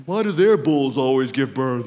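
low, comic grumble; mock complaint. Preacher joking as a farmer asking God about neighbor, mock-grumbling. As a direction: put on a low voice for a silly gripe.

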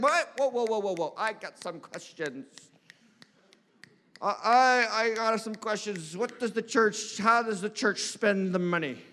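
softened, drawn-out mock hesitation; wary objector. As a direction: act out a suspicious member stalling slowly. Acting churchgoers balking at giving.

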